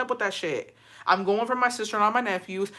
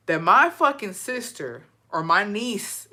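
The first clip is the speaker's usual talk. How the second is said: ordinary talk, nothing marked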